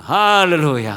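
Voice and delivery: loud hallelujah. Real, not acted. Preacher calling out to the church, loud hallelujah. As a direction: ring out Hallelujah with a loud, falling call.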